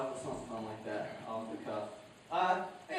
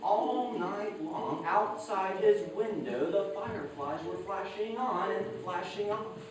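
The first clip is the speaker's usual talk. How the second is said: lively storytelling voice, higher and louder; story narration; 10 semitones higher, 8 dB louder than usual. A narrator's reading. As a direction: tell it to a listening crowd with swing. Storyteller performing folk tale with music.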